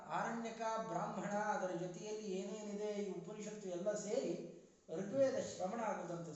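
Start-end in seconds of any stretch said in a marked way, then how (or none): none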